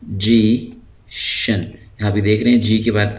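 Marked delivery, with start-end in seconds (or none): 0.0-1.7 मजीशन drawn out slowly with a j sound; slow model word. Shown as a demonstration. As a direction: stretch मजीशन, j not g. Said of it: "इसकी आवाज़ जीम होगी".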